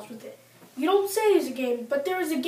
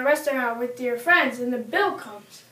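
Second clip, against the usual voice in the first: normal talking voice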